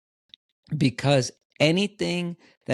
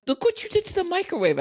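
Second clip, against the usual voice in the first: much higher, squeaky accusing voice; mock indignant squeak. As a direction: play up the outrage at the mess. Blaming wife over exploded coffee.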